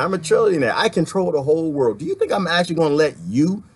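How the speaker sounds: normal talking voice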